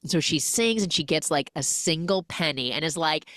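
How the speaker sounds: normal talking voice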